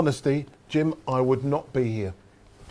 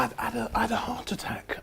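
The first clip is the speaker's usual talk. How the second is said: softer whispered confession; drops to a hushed whisper for the news; 6 semitones higher, 8 dB quieter, faster than usual. Real, not acted. Confessing his illness.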